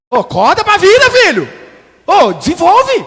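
high, loud fatherly rebuke; loud scolding. Acted. Father scolding his grown son to wake up, loud. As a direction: shout at him to grow up.

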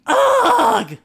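sharp high ugh; disgusted ugh. Acted. Kate groaning at Trent, sharp disgusted ugh. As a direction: spit out a fed-up groan. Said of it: "ugged Kate".

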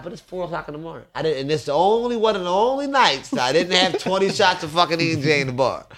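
usual speaking voice

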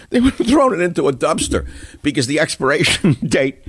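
laughing at his own joke as he says it. Real, not acted. Host laughing at his Biden joke to listeners, laughing through it.

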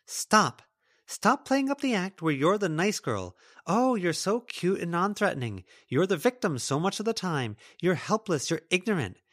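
high, heated accusing rant; angry tirade. Acted. Lila rants at Melody.

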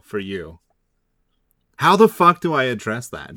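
ordinary talk

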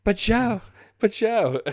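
high-pitched imitation of a partner objecting; mimicked high-pitched protest; mock pleading partner. Retelling partner's objection in acted voice.

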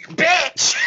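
high, loud mock possessed shout; sudden shouted insult. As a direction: blurt it out like a medium suddenly possessed. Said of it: "he just suddenly goes".

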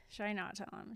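light singsong deliberation, falling back; weighing no. Retold or imitated. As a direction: drop the voice for the no side. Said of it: "she's been doing the, like".

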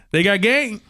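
high-pitched kid imitation; little kid's accent and voice. Eight-year-old with basketball asks speaker, imitated kid voice. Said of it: "he says it just like that".

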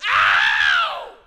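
long, very loud holler; drawn-out anguished outcry; loud cry of pain. Acted. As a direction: holler out in pain at full force. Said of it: "he cries out and he says".

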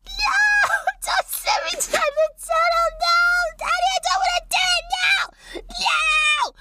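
put-on high, shrill panicked child's cry; screaming child. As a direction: shriek like a terrified kid begging. GM voices screaming kids.

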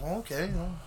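soft, unimpressed response; underwhelmed. Retold or imitated. Retelling his reply to the pulled-over friend, underwhelmed. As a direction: drop it quietly, at a loss for sympathy.

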